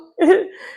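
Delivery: high giggle; high laugh. Real, not acted. Laugh after quoting friend.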